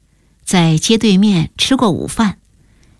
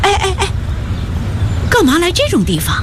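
much higher, startled protest; stunned; 10 semitones higher, faster than usual. A narrator's reading. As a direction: balk in astonishment, pitch jumping up. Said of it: "惊愕地问". (惊愕地高声问)

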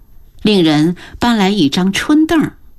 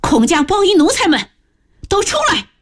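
high, commanding call to the servants; rallying shout; 10 semitones higher than usual. Acted. Mistress calls her household servants out, commanding shout. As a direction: summon everyone out at once. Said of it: "朝后边大喊一声".

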